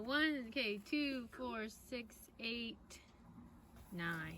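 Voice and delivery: hushed, counting eggs gently; soft counting. Real, not acted. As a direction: count along softly, under the breath. Mom counting eggs softly.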